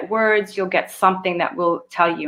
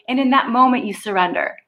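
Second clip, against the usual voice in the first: normal talking voice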